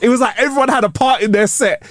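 usual speaking voice

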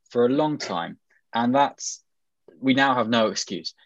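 ordinary talk

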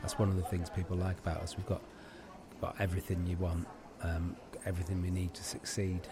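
low, soft, subdued sales talk; understated host patter. Acted. Coffee seller telling a customer about the shop, quiet. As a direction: quietly assure them in a low voice.

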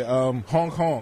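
slow goofy honk honk; silly clown honk. Shown as a demonstration. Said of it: "you have to do it".

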